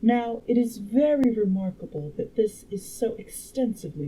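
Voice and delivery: lightly voiced, emphatic woman lecturer; a hint of a learned lady, punching key words; time traveler as a girl. Time traveler instructing the dinner guests, lightly voiced clever girl. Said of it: "make the time traveler a girl".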